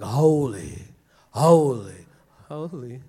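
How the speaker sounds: low, loud seraph call, fading on the last holy; deep voice. Acted. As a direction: boom it out like a giant angel. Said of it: "Who's got a deep voice here?".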